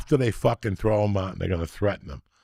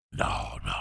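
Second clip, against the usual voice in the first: unvoiced hushed aside; whisper; 8 dB quieter than usual. Real, not acted. Whispering an aside to the co-host, hushed. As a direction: drop to a whisper.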